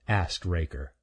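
usual speaking voice

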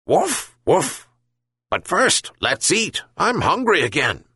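high dog voice. Acted. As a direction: play the hungry dog. Dog character voice.

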